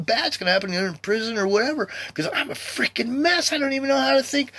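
high, strained pleading prayer; pleading as he did in tears; retold tearful plea. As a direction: beg God, voice climbing high. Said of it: "actually doing that in tears".

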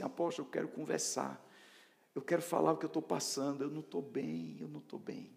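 hushed voice of someone confiding; private confiding. Acted. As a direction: confide softly, sinking away at the end. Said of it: "num reservado, e dizer assim".

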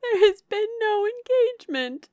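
very high, loud denial; vehement cry. A narrator's reading. Younger sister denying any engagement to Eleanor, vehement cry. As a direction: wail the denial at the top of the voice. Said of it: "cried Marianne".

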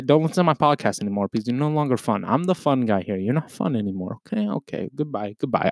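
ordinary talk

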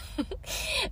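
quiet, high little laugh; small breath of laughter; soft chuckle. Real, not acted. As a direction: chuckle briefly at yourself. Laughs at her own muffin haul.

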